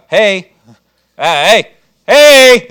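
loud hey hey building to a yell; yelling to wake someone. Retold or imitated. Preacher yelling at a sleeper to wake up. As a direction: holler till they wake. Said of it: "you're kinda yelling at 'em".

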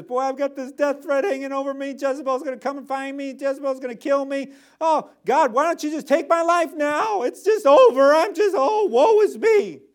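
high, loud, wailing self-pity; whiny despairing Elijah. Retold or imitated. Preacher voicing Elijah's despair, high and wailing. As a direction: wail it like a prophet who wants to quit. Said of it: "That's what he's saying".

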